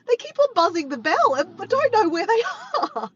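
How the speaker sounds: high, mock-exasperated whinge; high-pitched retold grievance, laughing at the end; imitated complaint. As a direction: mimic a fed-up victim, breaking into laughter. Retold complaint about doorbell pranksters.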